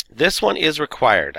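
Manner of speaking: slow emphasis on one and required; stressing required. Real, not acted. Teacher flagging the one required assignment, slow emphasis. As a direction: lean on one, slow and firm. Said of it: "I didn't say that earlier".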